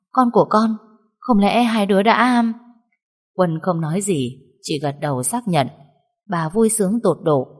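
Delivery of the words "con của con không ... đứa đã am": stunned disbelief. A narrator's reading. Mother stunned by the news.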